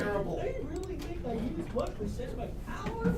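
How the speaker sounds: dark lord. Pretend. Villain line, much higher.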